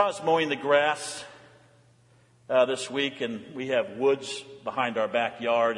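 usual speaking voice

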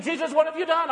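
challenging question. Retold or imitated. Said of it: "he allows us to ask him".

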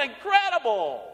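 ordinary delivery

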